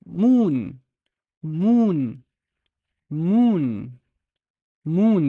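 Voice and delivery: "moon" as one drawn-out syllable with long oo; "moon" stretched, the waw read as long oo; long vowel demo. Shown as a demonstration. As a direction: hold the oo in "moon", pitch arching up and down. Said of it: "if I suddenly remove the fatha, it would sound".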